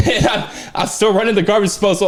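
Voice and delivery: normal talking voice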